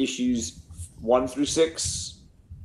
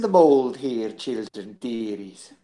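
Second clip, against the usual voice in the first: Mrs. Doubtfire; 2 semitones lower than usual. Retold or imitated. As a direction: coo it like a doting old nanny. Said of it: "a Missus Doubtfire impression".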